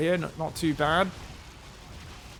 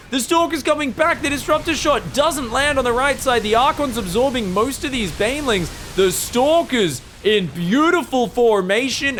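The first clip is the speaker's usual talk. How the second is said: much higher, loud play-by-play yell; excited shouting; 8 semitones higher, 6 dB louder than usual. Real, not acted. Caster to viewers during the big fight, shouting with excitement. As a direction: scream the fight as it happens. Caster calls huge engagement.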